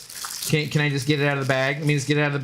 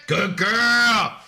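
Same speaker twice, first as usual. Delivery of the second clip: doggy praise; 6 semitones higher than usual. Real, not acted. Praising the dog.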